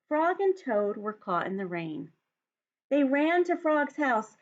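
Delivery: put-on lively read-aloud for young children; story narration. A narrator's reading. Teacher reads picture book aloud.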